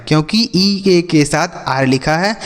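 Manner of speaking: ordinary talk, nothing marked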